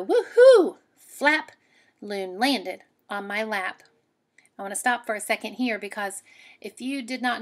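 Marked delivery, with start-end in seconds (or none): 0.0-1.5 loud, higher whoop on woohoo; excited woohoo. Acted. Reading the loon's flap, loud whoop. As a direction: whoop it like a happy bird.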